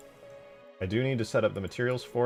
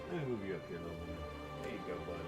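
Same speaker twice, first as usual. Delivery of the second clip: hushed, gentle murmur to a pet; soft pet talk; 14 dB quieter, much faster than usual. Real, not acted. Streamer moving a buddy up here, soft pet talk. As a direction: speak very softly, off to the side.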